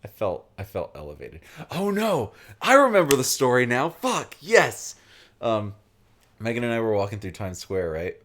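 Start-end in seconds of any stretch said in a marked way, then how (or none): 1.7-5.0 sudden loud, high cry of remembering; excited outburst. Real, not acted. To the friend, suddenly remembering the story, excited outburst. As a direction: burst out as the memory hits.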